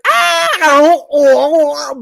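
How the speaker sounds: high gasping ahs and ohs, choking on a bird; mock stifled scream. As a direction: gag out little strangled cries, hammed-up. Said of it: "She's like".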